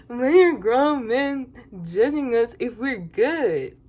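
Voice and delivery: slow, drawn-out whiny quote; mocking Huda. Quoting Huda's complaint, slow mock whine. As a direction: stretch it out, dramatic. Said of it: "Huda saying".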